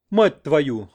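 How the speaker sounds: delighted curse. A narrator's reading. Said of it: "восторженно выругался".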